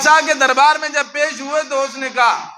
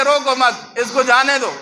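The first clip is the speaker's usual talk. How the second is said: "रोको मत" together, then "इसको जाने दो"; pause after मत. Shown as a demonstration. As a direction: keep मत with रोको, break before इसको. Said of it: "उस्ताद ने तो ये लिखा".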